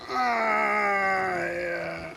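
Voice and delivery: long wail sinking in pitch; fading moan. Acted. Patient collapsing after outburst, fading moan. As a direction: groan out, trailing away. Victim wails and fades.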